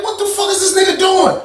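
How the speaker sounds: high-pitched retold disbelief; incredulous question. Retold disbelief at backwards walking.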